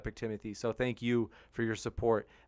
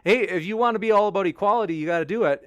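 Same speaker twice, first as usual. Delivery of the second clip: emphatic retort; 6 semitones higher, 7 dB louder, faster than usual. Retold or imitated. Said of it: "it's like".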